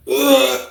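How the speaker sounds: high exasperated ugh; frustrated groan. Retold or imitated. Fed up after waiting an hour, high groan. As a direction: groan out your impatience.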